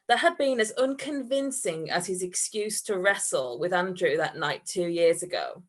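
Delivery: ordinary talk, nothing marked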